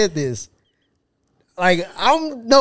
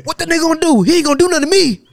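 high, loud dare; mock confrontational bravado; 10 semitones higher, 6 dB louder, faster than usual. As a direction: act out a cocky challenge. Acting out a confrontational friend.

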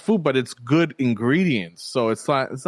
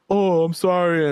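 mimicked apologetic guy; 5 semitones higher, slower than usual. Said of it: "He's like".

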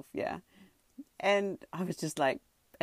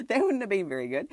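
laughing through the words, louder; 6 semitones higher, 6 dB louder, faster than usual. Real, not acted. Telling the viewers her near-blank moment, laughing. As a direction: giggle it out at yourself.

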